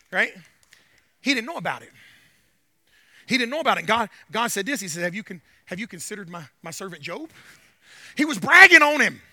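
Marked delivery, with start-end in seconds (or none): 5.0-7.3 low, soft question; God speaking. Retold or imitated. God asking Satan about his servant Job, low and soft. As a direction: ask it low and quietly.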